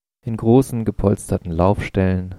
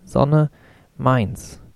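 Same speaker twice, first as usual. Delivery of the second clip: high ringing call; 5 semitones higher than usual. A narrator's reading. Toddler claiming the sun square, high insistent cry. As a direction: insist like a small child owning the sun. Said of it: "rief er mit hoher, tönender Stimme".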